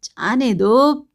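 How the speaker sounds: slow, dragged jeering; dragged drunken taunt; drawn-out jeer. Acted. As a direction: stretch it out tauntingly. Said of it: "शराबी सुनते ही चिल्ला उठा".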